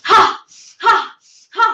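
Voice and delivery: short, explosive "ha" breaths; forceful exhale "ha". Shown as a demonstration. Teacher showing the class the breathing exercise, forceful "ha" exhales. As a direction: blow out sharp bursts through the mouth. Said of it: "नाक से सांस लो".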